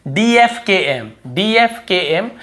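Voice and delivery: "डीएफकेएम" spelled letter by letter, very slowly. Shown as a demonstration. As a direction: drill the letters like a memory chant. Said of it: "सिर्फ चार चीज याद रखना है". ("डीएफकेएम" धीरे-धीरे रटवाते हुए)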